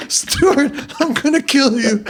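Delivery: high-pitched imitation of a livid brother; mock furious threat. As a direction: threaten your brother in comic, high exasperation. Brother threatens Stuart, retold.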